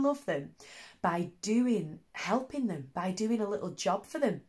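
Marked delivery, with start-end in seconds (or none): none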